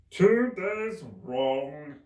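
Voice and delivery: very slow, loud sighed Hatter; sighing Hatter. A narrator's reading. Hatter looking at his broken watch, sighing slowly. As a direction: sigh it out slowly. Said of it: "sighed the Hatter".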